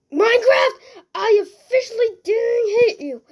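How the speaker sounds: high, loud outraged yell at the game; outraged shout. Real, not acted. Player blaming Minecraft for the blast, outraged shout. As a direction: shout in protest, high and wailing.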